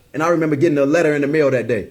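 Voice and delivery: ordinary delivery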